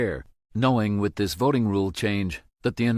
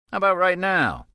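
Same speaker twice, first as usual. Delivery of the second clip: higher, louder playful tease; spring the surprise on him; playful teasing; 11 semitones higher, 5 dB louder than usual. A narrator's reading. Toby's playful surprise reveal.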